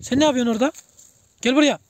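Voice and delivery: sharp loud summons to a pet; calling out. Real, not acted. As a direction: call the animal over, loudly. Calling out to the animal.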